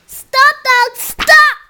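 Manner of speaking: high, loud shout of stop. Acted. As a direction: yell it like a command. Child shouting story command.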